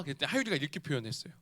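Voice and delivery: normal talking voice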